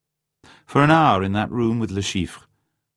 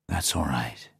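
softer and lower, soothing; calm her gently; comforting; 4 semitones lower, 7 dB quieter than usual. A narrator's reading. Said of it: "said Bond comfortingly".

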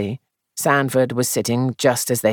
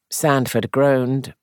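usual speaking voice

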